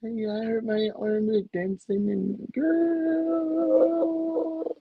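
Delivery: high, long drawn-out mock whine; singsong mockery of a put-on complaint; mocking her act. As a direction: stretch it out high, making fun of her. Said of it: "now she trying to be all".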